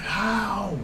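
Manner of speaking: high, hushed, mock-disbelieving "how"; incredulous. Fan retells his disbelief at the survival, incredulous.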